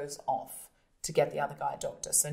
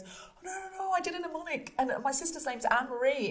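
much higher, flustered man backpedalling; panicked high excuse; 10 semitones higher than usual. Retold or imitated. Man caught out in bed, pleading in a high panic. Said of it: "he goes".

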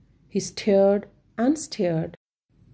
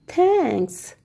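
gasped; 8 semitones higher than usual. Acted. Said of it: "gasped Rav".

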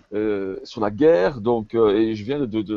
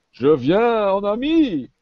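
high, drawn-out call; 11 semitones higher, 4 dB louder, slower than usual. Acted. Prêtre montre sa main au nain, appel lancé. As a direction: call out slowly in a raised pitch. Calls out to wary dwarf, hand shown.

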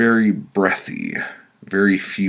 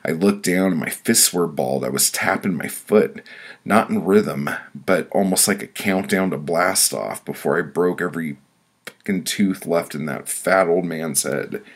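plain reading voice

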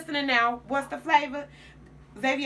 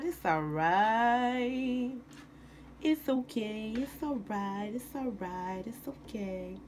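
slow soothing murmur fading softer; calm them down, softer and softer; 5 dB quieter, slower than usual. Real, not acted. Cook soothing someone off camera, slow and fading to soft.

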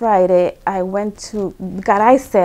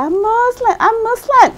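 retold emphatic insistence; 14 semitones higher, 4 dB louder than usual. Wife re-announcing her conversion to disbelieving husband, in retold high-pitched insistence.

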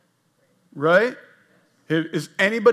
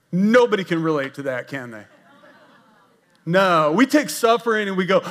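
ordinary delivery

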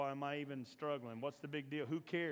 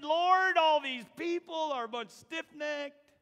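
higher, louder, slow weary plea; Moses complaining to God; 12 semitones higher, 11 dB louder, slower than usual. Acted. Moses pleading with God, higher and louder. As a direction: beg the Lord, fed up. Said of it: "he said".

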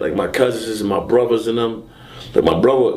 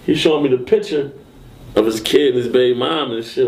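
ordinary talk, nothing marked

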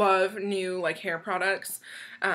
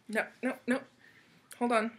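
ordinary delivery

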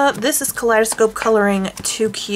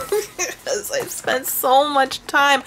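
laughing through it; 6 semitones higher, slower than usual. Real, not acted. Admitting she could cry, laughing at herself. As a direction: laugh breaking into the words.

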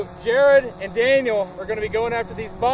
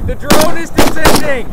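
yelling; 3 semitones higher, 7 dB louder than usual. Acted. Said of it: "I'm gonna be yelling words at you".

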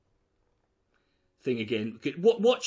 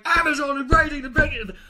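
higher and louder, hammed-up newsreader; mock excited newsreader; 9 semitones higher, 7 dB louder than usual. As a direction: gush the news like a drunk anchor. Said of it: "they weren't going".

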